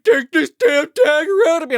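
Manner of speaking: high, loud pained wail; mock agony. Stabbed man begging for help, mock agony. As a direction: plead in hammed-up agony.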